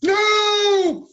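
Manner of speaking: high, loud mock protest; a big hammed-up no at the Bill joke; mock outraged shout. Reacts to "We hate Bill.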